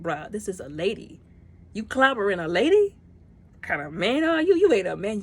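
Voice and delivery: righteous outrage at the villain; mock scolding. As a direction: tell him off for hitting a lady. Scolding villain for hitting Nebula.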